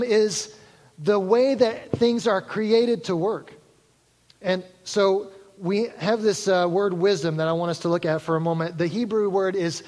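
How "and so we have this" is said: ordinary talk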